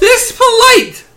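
very high, slow, loud squeal; playful high-pitched holler; mock protest. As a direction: drag it out in hammed-up indignation. Mock protest about Southern politeness.

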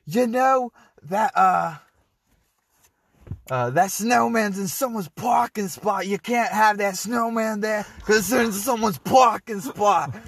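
put-on high, loud Bronx-accent complaint; imitated Bronx accent. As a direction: holler it in a mock Bronx accent. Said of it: "in an accent".

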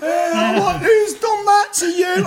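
very high, drawn-out cry; hammed-up distraught mate, slow and shrieky; mock tearful wail. High-as-a-kite mate seeing things, mock tearful wail. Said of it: "crying his eyes out".

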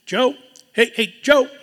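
high, loud call across a room. Shown as a demonstration. Calling out to get a friend's attention, loud and high. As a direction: shout a name to grab attention. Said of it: "what do we do?".

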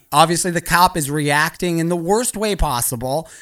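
ordinary delivery

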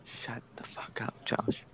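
hushed whisper. Real, not acted. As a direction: whisper it sharply to a friend. Hushing a friend mid-question.

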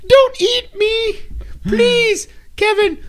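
high, slow, tiny pleading voice; gingerbread man voice. Acted. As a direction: squeak like a terrified cookie. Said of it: "sounds like the gingerbread man".

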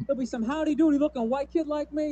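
ordinary talk

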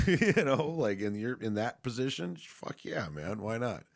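high, loud laughing agreement. Real, not acted. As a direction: laugh it out, all in.

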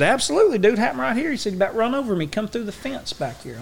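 high, eager witness; trucking worker eagerly confirming what he saw; excited. Retold or imitated. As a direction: pitch it up, sure of himself.

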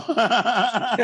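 high burst of laughter; laughing. Real, not acted. Artist laughing with host over being kicked off Twitter, high laugh. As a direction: laugh out loud, pitched high. Both joke about being censored online.

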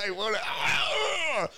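high, slow fake sobbing wail; mock wailing. Mocking a triggered leftist, hammed-up crying wail. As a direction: whimper like a toddler melting down.

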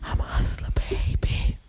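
breathy ASMR whisper, unvoiced. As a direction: whisper close to the mic like an ASMR video. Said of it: "I can't do that A S M R shit".

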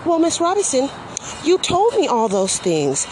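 lightly voiced prim caseworker, high pitched; mocking the caseworker. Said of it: "She says".

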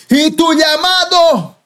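high, slow, drawn-out call like a trumpet blast; God's booming call. Retold or imitated. God confronts the runaway pastor, booming and slow. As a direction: ring out like a giant horn from heaven. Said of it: "Como si fuera un grito".

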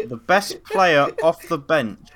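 loud slow question announcement with a laugh. Real, not acted. Host reads the next question to friends, loud laughing announcement. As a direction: announce it grandly while laughing.

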